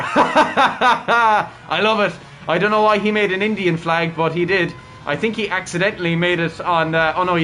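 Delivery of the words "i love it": hearty laugh running into the words; real laughter. Real, not acted. Streamer amused by the driver's car lettering, laughing. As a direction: burst out laughing, then speak.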